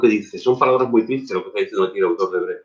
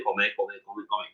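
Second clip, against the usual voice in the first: fading repetition; 13 dB quieter, faster than usual. Acted. Fading repeat of comer.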